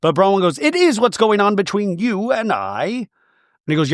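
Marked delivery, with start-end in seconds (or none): 0.6-3.1 high-pitched imitation of an arguing wife; mock indignant wife. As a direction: put her on, pitched well up. Voicing Bronwyn in an argument.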